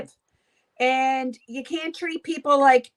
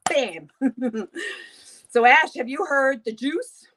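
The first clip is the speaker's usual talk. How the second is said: normal talking voice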